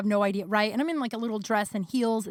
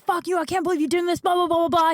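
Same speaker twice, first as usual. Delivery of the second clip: high, fast mock tirade; retold flip-out; 8 semitones higher, 5 dB louder, faster than usual. Drunk boyfriend flipping out at her, retold high and fast. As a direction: imitate his angry rant, trailing into blahs. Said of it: "he flipped the fuck out at me".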